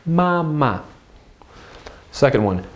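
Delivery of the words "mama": "mama" high and level, then dropping off; high-then-light tones on "mama"; first tone then neutral. Shown as a demonstration. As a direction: hold the first syllable of "mama" high, let the second fall light. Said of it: "The second one is neutral".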